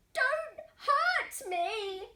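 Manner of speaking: high, slow, crying plea; whimper. Acted. Frightened lion whimpering to the mouse. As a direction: whimper it out tearfully. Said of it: "he whimpered".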